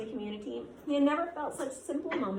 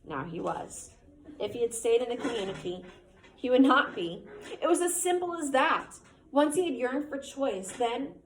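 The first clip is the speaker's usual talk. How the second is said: story narration; 3 dB louder than usual. A narrator's reading. Teacher reads the novel aloud.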